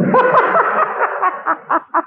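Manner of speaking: a high laugh in the middle of the sermon; short high burst of laughter. Real, not acted. Laugh heard.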